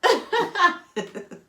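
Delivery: high, loud burst of laughter; a big loud laugh. Real, not acted. Laughing at own joke.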